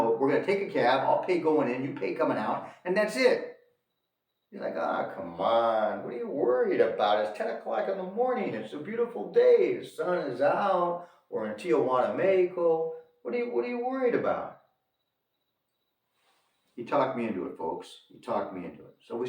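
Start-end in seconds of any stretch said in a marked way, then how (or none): 4.8-14.6 mock a breezy friend waving off worries; carefree reassurance. Friend brushing off the speaker's worry.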